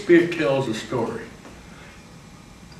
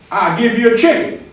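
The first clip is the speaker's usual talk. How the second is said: Mister Lutz; 8 semitones higher, 6 dB louder than usual. Acted. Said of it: "Mister Lutz said".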